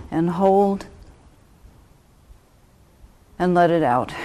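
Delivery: calm meditation guide with long pauses; unhurried, even instructions with space to breathe; guided meditation. Real, not acted. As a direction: ease listeners into stillness, phrase by phrase.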